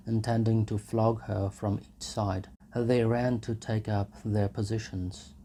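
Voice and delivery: plain reading voice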